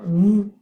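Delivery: "mu" said alone, loud; secondary stress demo. Shown as a demonstration. Teacher marks the secondary stress for students, loud. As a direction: punch out "mu" as a loud separate syllable. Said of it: "un accent secondaire".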